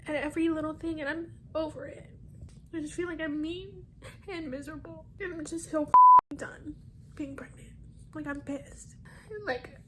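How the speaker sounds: fed up and tearful; upset, crying complaint. Real, not acted. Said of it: "cried like this".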